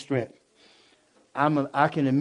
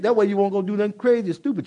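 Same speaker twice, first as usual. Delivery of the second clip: usual speaking voice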